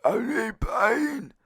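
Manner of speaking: high, drawn-out mock wail; woman's pleading voice. Woman begging the narrator's friend, high put-on wail. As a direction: imitate her in a slow, high-pitched put-on voice.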